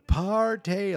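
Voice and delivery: high, drawn-out mock party whoop; beer-commercial party cry. Kids cut loose once parents' light goes off, mock party cry. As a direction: holler it like partiers in a beer ad. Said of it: "they're like in a beer commercial".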